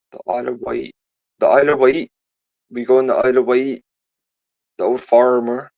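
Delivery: put-on rolling the R hard, old rural Isle of Wight accent; Isle of Wight farmer accent. Shown as a demonstration. Showing a mate the dead Isle of Wight farmer accent, R rolled. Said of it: "Yeah, that type of shit".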